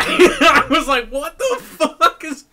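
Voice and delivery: high laughing disbelief; cracking up in disbelief. Real, not acted. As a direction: laugh through the words, high.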